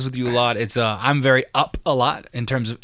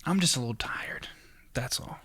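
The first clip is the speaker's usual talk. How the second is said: whispered and much softer; tired whisper; 13 dB quieter than usual. Retold or imitated. Answering people who ask what's wrong, tired whisper. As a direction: let it fade out in a worn whisper. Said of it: "No, there's nothing really wrong".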